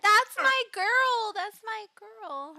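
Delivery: high, slow, drawn-out fondness; doting. Real, not acted. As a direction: gush proudly over your darling. Fussing fondly over Lev.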